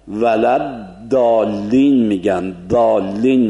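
ولد دالین with a heavy emphatic d; دالین, d made emphatic the Levantine way; emphatic dal. Shown as a demonstration. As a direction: say دالین with a thick, dark d.

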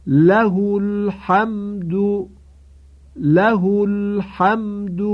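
له with short ha before الحمد, slow chant; Qur'anic recitation. Shown as a demonstration. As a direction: chant slowly, ha not lengthened. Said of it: "مثال برای جایی که". (تلاوت له الحمد بدون اشباع)

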